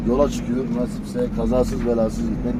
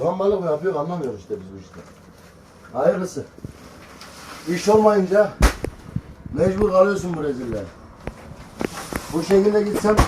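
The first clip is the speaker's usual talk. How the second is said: exasperated; 6 semitones higher than usual. Real, not acted. Venting about unwanted hauling work.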